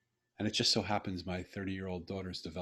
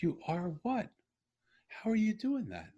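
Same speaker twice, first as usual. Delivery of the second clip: amazed question; 13 semitones higher than usual. Retold or imitated. Amazed father quizzing smiling daughter.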